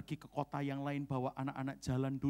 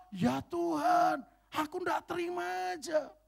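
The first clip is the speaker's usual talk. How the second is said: indignant protest; 5 dB louder than usual. Retold or imitated. Pastor retelling his protest to God, high indignant cry. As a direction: cry out that you won't accept it.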